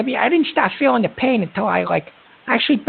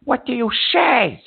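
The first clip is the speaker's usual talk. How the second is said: drawn-out call-out. Acted. Host calling a silent caller.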